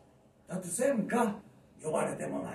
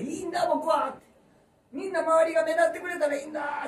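meek Pinocchio; 10 semitones higher, 6 dB louder than usual. Pretend. Pinocchio voiced by comedian.

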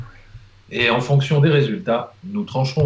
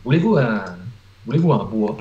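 mock Quebec French accent; light Quebec French accent; 5 semitones lower than usual. Shown as a demonstration. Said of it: "très bon accent canadien".

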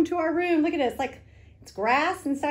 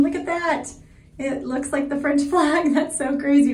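ordinary talk

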